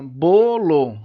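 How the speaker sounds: "bolo" loud, split as bo, lo; syllable by syllable. Shown as a demonstration. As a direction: stretch "bolo" into two separate beats. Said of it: "São duas sílabas".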